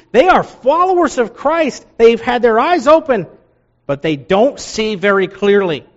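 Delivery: high, loud emphatic preaching; preaching climax. Acted. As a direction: drive the point home.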